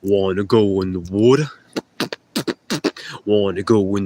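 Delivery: lightly hammed New Yorker chatting up a model; fake New York accent. Asking the model to swim, in mock New York accent. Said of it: "talking like a California actor impersonating a New Yorker".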